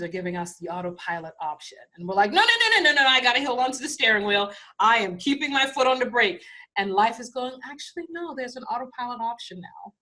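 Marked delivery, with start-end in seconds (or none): 2.3-6.4 sudden much higher, louder no's, then fast clinging protest; panicked refusal. Acted. Humans refusing life's autopilot, panicked loud protest. 7.6-9.9 gentle voice of life. Acted. Voicing life answering gently.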